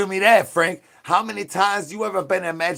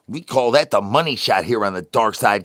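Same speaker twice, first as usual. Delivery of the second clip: ordinary talk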